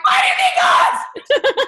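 high, loud, slow laughing yell; laughing shout of relief. Retold or imitated. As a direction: shout it while cracking up. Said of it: "I'm like".

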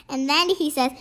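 ordinary delivery, nothing marked

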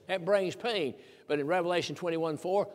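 normal talking voice

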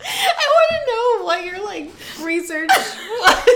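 high, squeaky question through laughter; breaking into giggles on the words; laughing. Real, not acted. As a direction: ask it while cracking up. Laughing at the accent script.

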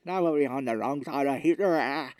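slurred, mangled mock Novocaine speech; hammed-up drooling through a numb mouth. Numb actor trying to look hot, slurred drooling speech. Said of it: "And he's drooling".

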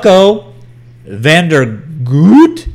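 Vandergoot stretched very slowly, loud; drawn-out name. Shown as a demonstration. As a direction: say Fucko Vandergoot slowly and carefully. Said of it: "the reason why I say Vandergoot".

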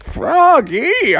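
high-pitched parent voice calling Froggy; a squeaky old mom crying out the name. Acted. Old mom and dad calling their son, high squeaky voice. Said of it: "his mom and dad were like".

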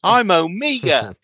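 put-on slow New Zealand accent; Kiwi accent. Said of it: "your little Kiwi accent".

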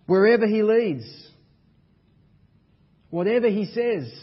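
loud, high, driving sermon climax; preaching at full force. Acted. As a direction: hammer each line home to the congregation.

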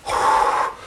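big exhale, acted out; relieved sigh. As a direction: let out a long breath of relief. Relief after winning the case.

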